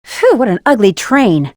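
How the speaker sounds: normal talking voice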